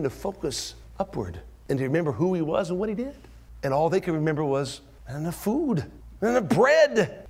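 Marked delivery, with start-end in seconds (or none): 5.1-7.2 higher, whiny; voicing the disciples' complaint; mock disciples. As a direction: mimic their small worry.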